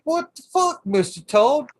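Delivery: high, loud yell at the toad; exasperated yell. Retold or imitated. Shouting at the toad in his seat case, high and loud. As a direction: shoo the toad out, fed up. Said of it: "I'm like".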